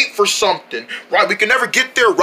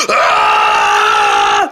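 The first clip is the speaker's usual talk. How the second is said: scream; 3 dB louder than usual. Acted. Scream in chaos.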